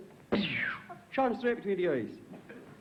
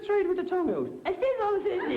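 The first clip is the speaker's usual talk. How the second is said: high voice; 11 semitones higher than usual. Acted. Shoe joke punchline voices.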